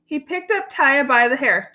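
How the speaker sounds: usual speaking voice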